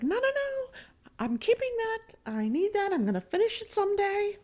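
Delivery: put-on very high pleading old woman; high, frantic clinging to her things; whiny old lady. Grandma refusing to give up diamond paintings, very high whine.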